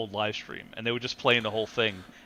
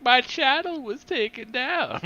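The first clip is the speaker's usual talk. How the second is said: much higher and slow, whining; high, slow mocking whine; whiny streamer impression; 13 semitones higher, 4 dB louder, slower than usual. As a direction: play a sulky man-child complaining. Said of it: "he'd be like".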